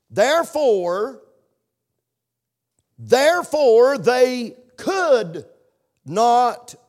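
slow, loud, high Therefore, therefore they could not; emphatic scripture reading. Acted. Preacher to congregation reading verse, slow and booming. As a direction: draw out each word and hammer it home.

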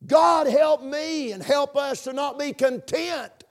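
impassioned pulpit plea; fervent preaching. Real, not acted. Preacher pleading against empty church ritual, fervently. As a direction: drive each phrase home with rising fervor.

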